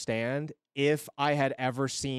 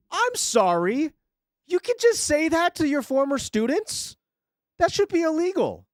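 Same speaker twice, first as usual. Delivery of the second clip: outraged; 13 semitones higher, 4 dB louder than usual. Real, not acted. Outraged at the teacher's comment.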